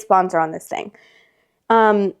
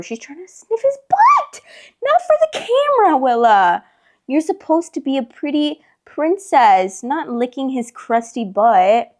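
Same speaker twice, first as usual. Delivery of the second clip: high, sing-song voice to the cat; pet talk; 5 semitones higher than usual. Real, not acted. Owner scolding Willa the cat, high, slowed baby talk.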